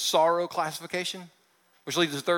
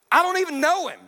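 high, loud angry denial; retold yell; 10 semitones higher, 6 dB louder, faster than usual. Peter yelling at the servant girl, loud denial. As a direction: shout it like a cornered man. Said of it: "Peter yells back at her".

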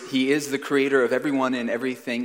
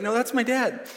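put-on much higher insistent little kid voice; child's voice; 11 semitones higher than usual. Son Jet insisting to the visiting boy, in a high child's voice. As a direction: pipe up high like a small son defending his dad. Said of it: "And Jet's like".

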